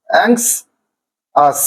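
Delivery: Anxious very slowly, in two halves; long pause between অ্যাংশ and আস in anxious; syllable by syllable. Shown as a demonstration. Teacher repeating the division, anxious in two slow separate syllables. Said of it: "এভাবে".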